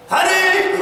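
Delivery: high loud panicked call; high, loud hammed-up panic; alarmed shout. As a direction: yell for her, waking up late. Husband waking late, calling wife.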